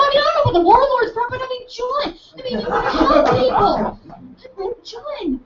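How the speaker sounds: eager high-pitched encouragement; excited hedge. Retold or imitated. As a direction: keep the excited high energy. Excited affirming follow-up.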